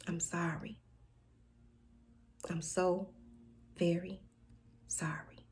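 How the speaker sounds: soft broken whisper, almost breathless; whispered apology. Acted. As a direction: let the apology fall to a whisper.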